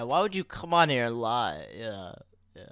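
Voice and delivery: ordinary talk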